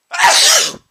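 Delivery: huge high explosive sneeze; gigantic sneeze. Real, not acted. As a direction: sneeze as hard as you can. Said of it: "that was another gigantic sneeze".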